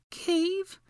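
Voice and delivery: high, frightened whimper. A narrator's reading. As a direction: whimper it as a dreading question. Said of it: "Hermione whimpered".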